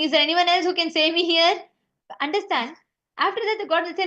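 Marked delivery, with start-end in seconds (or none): 0.0-1.5 raised, louder plea for help; mock panicked shout from the cliff; retold shout. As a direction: call out a bit louder, half-hearted. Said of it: "Then he'll start shouting".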